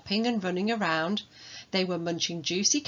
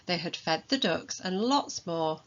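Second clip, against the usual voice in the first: usual speaking voice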